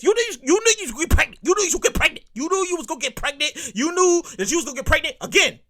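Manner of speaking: usual speaking voice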